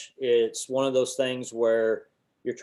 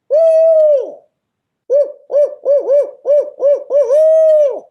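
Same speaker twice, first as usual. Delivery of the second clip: very high owl hooting by voice; loud enthusiastic hoot; 16 dB louder than usual. Shown as a demonstration. Hunter demonstrating his voice locator call, loud enthusiastic hooting. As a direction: belt out an owl call with your voice. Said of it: "That's louder, more enthusiasm".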